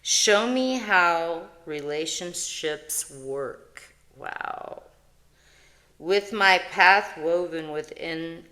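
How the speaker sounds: slow, solemn reading of a spiritual verse; reading card text. Acted. Vlogger reading her oracle card to viewers, solemn and slow. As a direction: intone the card like a prayer.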